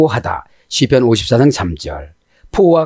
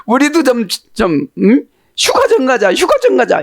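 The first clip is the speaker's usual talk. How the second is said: high, whiny pleading wife; nagging wife; 12 semitones higher than usual. Retold or imitated. Noah's wife begging him for a holiday, whiny. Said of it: "노아 부인은 이랬을 것이고".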